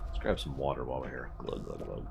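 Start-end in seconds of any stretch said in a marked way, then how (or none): 1.4-2.1 low, soft glug sounds; glugging noise. Pretend. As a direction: mouth the gulps quietly. Drinking water in game.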